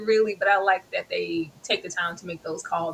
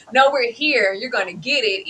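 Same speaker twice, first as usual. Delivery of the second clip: ordinary talk, nothing marked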